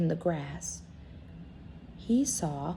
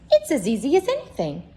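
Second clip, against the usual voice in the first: furry rabbit; 6 semitones higher, 5 dB louder, faster than usual. Acted. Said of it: "said the furry rabbit".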